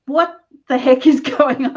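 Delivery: loud baffled what-the-heck; exasperated outburst. Retold or imitated. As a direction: blurt it out in disbelief. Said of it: "I was about to say something more fruity then".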